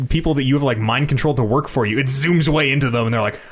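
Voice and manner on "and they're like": ordinary delivery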